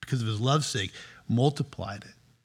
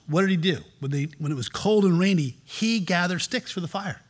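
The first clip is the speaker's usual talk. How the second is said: normal talking voice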